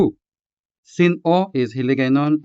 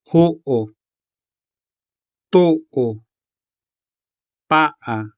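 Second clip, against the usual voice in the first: Ho'o, to'o, pa'a with the weight on ho, to, pa; stress on first syllable; 2 semitones higher, much slower than usual. Shown as a demonstration. Teacher replaying the words so learners hear first-syllable stress. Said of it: "the stress falls on the first syllable".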